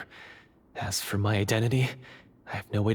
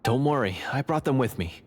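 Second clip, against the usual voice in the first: ordinary talk, nothing marked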